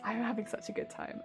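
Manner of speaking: usual speaking voice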